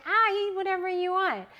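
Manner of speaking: airy, high, brushing-off mock voice; dismissive mock quote. Mocking people who say eat whatever you want, in a high, dismissive voice. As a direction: toss it off like it doesn't matter.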